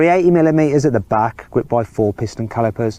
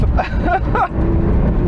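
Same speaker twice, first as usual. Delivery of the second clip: laugh. Real, not acted. Laughing while driving the car.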